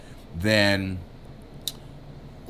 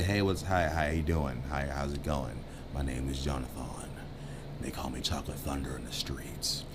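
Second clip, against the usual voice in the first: mock smooth pickup line; 6 semitones lower, 9 dB quieter than usual. Shown as a demonstration. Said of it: "that's firm but funny".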